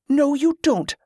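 high-pitched, sharp interruption; snapping. A narrator's reading. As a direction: cut him off with irritation. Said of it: "Bela snapped at him".

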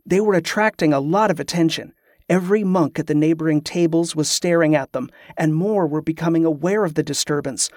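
plain reading voice

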